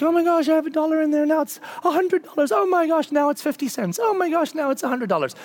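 high, fast "oh my gosh" swings of a market watcher; frantic mock investor.

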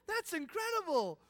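high, loud shout of delight; hammed-up awestruck yell; amazed crowd. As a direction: cheer for an encore. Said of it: "And they all go".

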